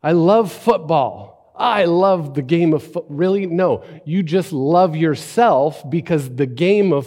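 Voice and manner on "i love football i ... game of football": higher, louder, slow gushing fan; imitating sports fan. Preacher mimics a sports fan gushing about football, higher and louder. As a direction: gush like a hammed-up football fanatic. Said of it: "We say".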